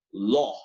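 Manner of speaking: LAW ending on a plain open O; flat dry O. Shown as a demonstration. Teacher explains the word to students, LAW with a dry O. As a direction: say LAW like "loh", no U. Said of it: "como una O plana".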